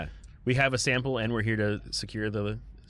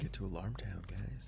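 breathy conspiratorial whisper; 4 semitones lower, 14 dB quieter than usual. Real, not acted. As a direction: whisper it to the team. Whispered plan to party.

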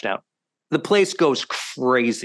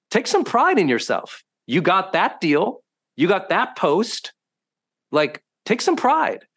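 emphatic pep talk; 4 semitones higher than usual. Retold or imitated. Urging his bemoaning friend on a walk, emphatic pep talk. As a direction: drive it home to a friend, drawing out the words.

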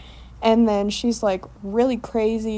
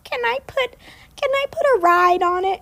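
mimicking friend; 14 semitones higher than usual. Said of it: "she said".

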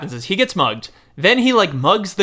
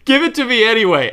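breezy demand; 8 semitones higher, faster than usual. Retold or imitated. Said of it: "And he goes".

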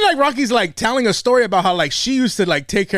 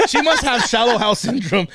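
ordinary talk, nothing marked

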